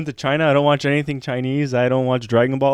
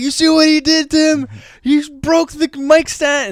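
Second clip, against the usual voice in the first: retold outraged yell; 7 dB louder than usual. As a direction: cry out like you're appalled on someone's behalf. Retold outburst at the crowd.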